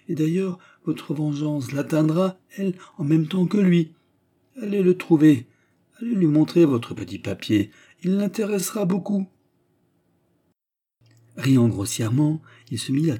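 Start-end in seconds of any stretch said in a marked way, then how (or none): 0.1-9.3 high-pitched, animated goading; eager incitement. Acted. Manager sending the suitor to the rival, goading. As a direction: egg him on with relish.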